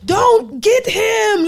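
high, loud, drawn-out shout; stretched-out high yell of protest. Retold or imitated. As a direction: plead loudly with the TV screen. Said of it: "I be yelling at the T V".

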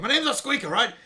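a hammed-up indignant kid; indignant protest. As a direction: bristle at the nickname, loud and high. Indignant character retort.

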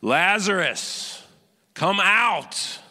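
summoning Lazarus by name; loud call. A narrator's reading. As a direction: call him out of the tomb. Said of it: "called out in a loud voice".

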